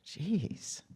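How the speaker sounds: usual speaking voice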